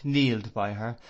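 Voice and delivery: ordinary delivery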